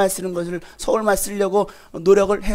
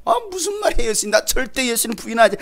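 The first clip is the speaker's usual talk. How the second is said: Peter's protest; 7 semitones higher, faster than usual. Retold or imitated. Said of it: "라는 그 말에".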